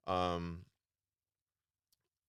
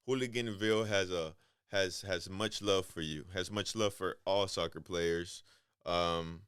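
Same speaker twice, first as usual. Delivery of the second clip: ordinary talk, nothing marked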